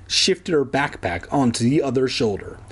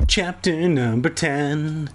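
slow announcer read; chapter announcement; 2 semitones higher, slower than usual. A narrator's reading. Reader opening a new chapter, slow announcer read. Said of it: "announcer read of chapter".

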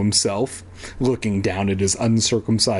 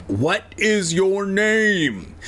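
very slow, raised-pitch call, word by word; slow shout; 7 semitones higher, much slower than usual. A narrator's reading. Officer shouting up at Evan, slowly, word by word. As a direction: space out each word as if for a non-speaker. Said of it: "he shouted, speaking each word slowly".

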